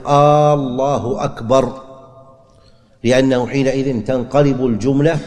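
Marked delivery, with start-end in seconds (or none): 0.1-1.8 "آه الله أكبر" with the opening hamza stretched into a long "aa"; a slow, drawn "aa" at the start of "آه الله أكبر". Shown as a demonstration. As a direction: stretch the first vowel of "آه الله" slowly so it sounds like a question. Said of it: "فلا يصح أن يقول".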